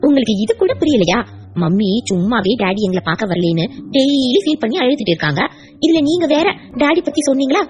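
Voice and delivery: a hint of a child chiding her grandpa; mild childlike reproach; lightly voiced little girl.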